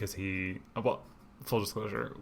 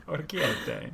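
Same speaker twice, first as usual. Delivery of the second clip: "Orecchiette" pitched higher in full Italian; Italian accent; 6 semitones higher, slower than usual. Shown as a demonstration. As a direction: mimic the Italian "Orecchiette" playfully. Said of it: "Oh, it's orecchiette".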